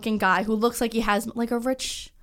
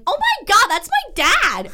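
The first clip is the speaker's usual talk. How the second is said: high, loud, shocked cry; shocked exclamation; 10 semitones higher, 7 dB louder, slower than usual. Retold or imitated. Telling the car dealer lady he is her dad, shocked. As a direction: blurt it out in disbelief. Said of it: "And I was like".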